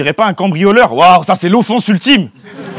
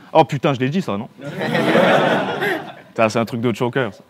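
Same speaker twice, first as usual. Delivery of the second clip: low mumbled aside; 7 semitones lower, 8 dB quieter than usual. Real, not acted. Aside after own punchline.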